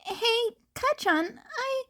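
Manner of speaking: put-on a high boyish hello that fades out; eager young Izuku. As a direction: start brightly, then get cut off. Izuku taps Bakugo's shoulder.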